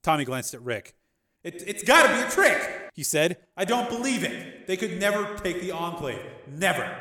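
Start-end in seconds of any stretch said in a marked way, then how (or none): 1.4-2.9 high, loud, stammered disbelief; agitated denial. Acted. Gangster hears his captive father, agitated denial. 3.6-7.0 high-pitched disbelief, falling at the end; agitated denial. Acted. Gangster insists to Rick it's impossible, agitated. Gangster refuses to believe father.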